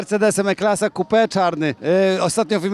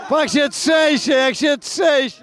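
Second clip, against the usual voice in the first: excited mock commentary; 5 semitones higher, slower than usual. Real, not acted. Host mocks shaking push-up guy.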